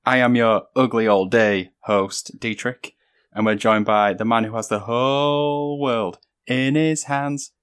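drawn-out grand host introduction; slow announcer voice. Acted. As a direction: introduce guests slowly, with ceremony.

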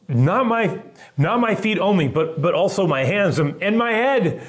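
high, loud over-eager plea; acted-out overexcited disciple, climbing to the end; eager outburst. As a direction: blurt it out with stumbling eagerness. Peter swings to eager extreme.

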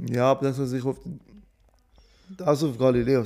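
talking while eating a biscuit; words round a bite of cookie; mouth full. Real, not acted. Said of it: "ich riss grad einen Keks".

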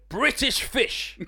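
slow, drawn-out posh "British fish"; posh demo. Shown as a demonstration. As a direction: stretch "British fish" out like a Tory grandee. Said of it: "Like, like Jacob Rees-Mogg".

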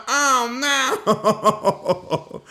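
high, laughing groan of dread; mock dismay. Reacting to the pickup call, mock dismay. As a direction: wail it playfully, half laughing. Retold dismay at being picked up.

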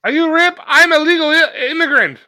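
high, loud mock immigrant voice. Movie watcher mimicking an immigrant meeting Rip, high mock voice. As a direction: squeal it out as a hammed-up immigrant.

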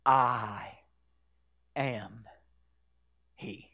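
low and fading, word by word; hushed revelation. Acted. Jesus answers the Samaritan woman, hushed revelation. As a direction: isolate each word, sinking almost to nothing. Repeats Jesus's words in hush.

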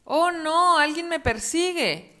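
high, loud panicked cry; frightened fox. Acted. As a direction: cry out while fleeing. Zorro asustado. (zorro asustado y agudo)

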